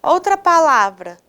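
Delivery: usual speaking voice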